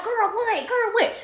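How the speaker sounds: high-pitched gossipy disbelief; shocked friend. Retold or imitated. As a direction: react high and incredulous down the phone. Friend reacting on phone, retold.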